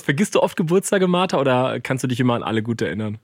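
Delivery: ordinary talk, nothing marked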